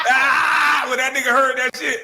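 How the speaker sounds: high, loud laughing outburst; laughing shout. Real, not acted. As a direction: burst out laughing loudly.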